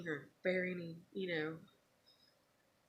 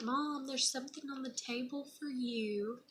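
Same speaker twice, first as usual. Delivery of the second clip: put-on higher little-girl voice; little daughter; 6 semitones higher than usual. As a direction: imitate a little girl announcing a surprise. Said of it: "And she's like".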